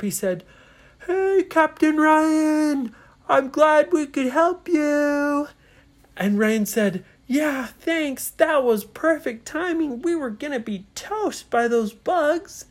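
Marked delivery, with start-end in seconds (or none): 1.1-5.5 loud, high, drawn-out holler; yelling up. A narrator's reading. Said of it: "Spencer yelled up". 7.3-12.7 high, excited calling back; relieved thanks. Acted. Ryan calls back thanks after rescue.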